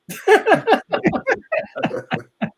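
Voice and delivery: high burst of laughter; big laugh. Real, not acted. Teller cracking up at his own story, high laughter.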